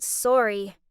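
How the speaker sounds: ordinary delivery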